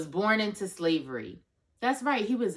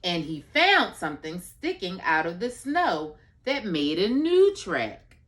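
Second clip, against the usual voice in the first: slow, drawn-out storybook reading; reading to a toddler; 3 semitones lower, 4 dB louder, slower than usual. Acted. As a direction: stretch it out for a toddler. Reads picture book aloud.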